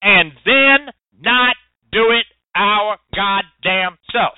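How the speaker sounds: drawn out, every word weighed; slow it right down and land each word; deliberate slow repeat for emphasis; slow and emphatic. Shown as a demonstration. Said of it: "Let me say that again".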